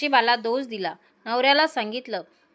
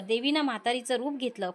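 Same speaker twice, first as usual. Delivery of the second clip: usual speaking voice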